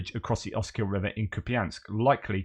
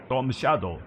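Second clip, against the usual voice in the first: put-on Ukrainian accent; 3 semitones higher than usual. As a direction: Storm Shadow in a light Slavic accent. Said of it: "My best impression of a Ukrainian or Russian doing English".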